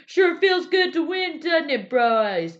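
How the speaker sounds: high, loud, cocky bro shout; sous chef gloats at the winning team, high and loud; gloating sous chef. Retold or imitated. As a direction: crow it like a smug host handing out a prize.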